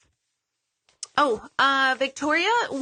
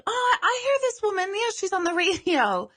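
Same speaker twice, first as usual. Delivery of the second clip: much higher, eager shop woman; recognising clerk; 11 semitones higher than usual. Retold or imitated. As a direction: gush like a clerk who just placed the name. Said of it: "And the woman's like".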